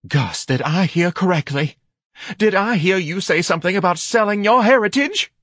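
high voice rising in indignation; shocked wife. A narrator's reading. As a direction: question the husband in scandalized disbelief.

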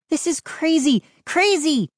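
high-pitched disbelief; exasperated cry. A narrator's reading. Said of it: "Mindy cried".

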